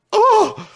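very high, loud mock shriek; horrified yelp. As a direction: cry out as if something precious got tossed. Reacting to boogers thrown away.

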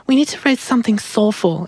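very high-pitched imitation of a co-writer; Julie's voice. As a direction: put on her high little voice. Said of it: "She talks like this".

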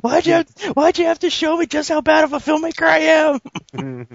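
high, shouted mock despair; mock anguished shout. Battleship director yelling at Avengers, high mock wail.

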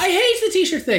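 high, exasperated burst of agreement; emphatic exclamation. Real, not acted. Gig-goer agreeing about people taking t-shirts off, vehement. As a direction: jump in with pitch soaring.

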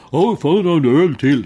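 loud, high Dalarna dialect grumble; a Dalarna man demanding another beer, loud and high. Retold or imitated. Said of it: "Jag hörde mitt dalmål där". (högljudd, ljus dalmålsröst som kräver en öl till)

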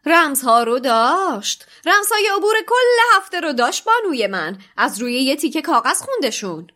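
loud, high, proud announcement; proudly. A narrator's reading. The knight proudly telling the professor, boastful. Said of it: "با افتخار گفت".

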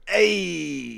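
sudden loud, pleased "hey"; delighted exclamation. Real, not acted. Podcaster catching a co-host's pun, loud delighted hey.

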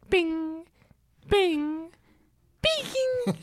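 high item chime; rising bing sound effect; Mario Kart bing. Pretend. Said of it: "Mario Kart".

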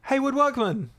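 Hayward said high with sudden recognition; realization, correct name. Real, not acted. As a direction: say Hayward like it just clicked.

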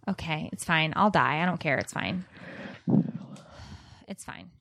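sighing, fading into near silence; mock martyrdom. Complaining about the hot room, fading mock martyrdom.

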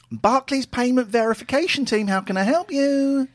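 high, loud call-centre greeting. Pretend. Fake bank agent answering a worried customer, high and loud. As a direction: put on the bright phone agent.

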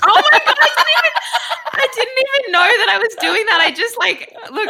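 high, loud, laughing disbelief at herself; shocked giggle over her own habit; surprised laugh. Real, not acted. Guest surprised at herself, breaking into high laughter. Catches herself holding a crystal.

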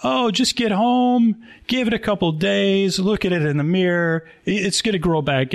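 high, singsong mock reassurance; bad haircut speech. Barber consoling a customer over a bad haircut, rehearsed reassurance. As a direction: lightly play a barber soothing a customer with a stock patter. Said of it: "a bad haircut speech ready".

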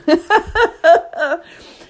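loud, high laughing fit; big burst of laughter. Real, not acted. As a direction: crack up at the absurdity. Laughing about Team Panic flyers.

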